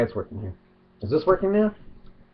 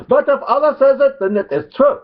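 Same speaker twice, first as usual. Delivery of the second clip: high, loud mock Arabic accent; 13 semitones higher, 4 dB louder than usual. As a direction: parody the believer in a hammed-up Arabic accent. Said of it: "mock Arabic accent".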